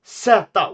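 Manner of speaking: zeta said "seta" with an s and open a; Swedish zeta. Shown as a demonstration. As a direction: zeta with s sound, stressed. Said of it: "we say zeta".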